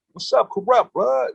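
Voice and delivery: high-pitched mock call-out; re-enacted holler at normal volume; retold yell. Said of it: "So I yell out".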